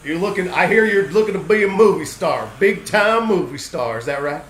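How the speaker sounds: a hint of a smooth-talking Texan big shot; Texan producer voice. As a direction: lay on the charm, cowboy-style.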